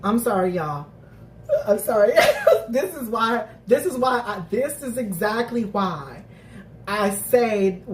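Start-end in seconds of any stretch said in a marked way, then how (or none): 2.2-2.6 very high, loud squealing laugh; squeal of laughter. Real, not acted. Apologising to viewers, then squealing with laughter.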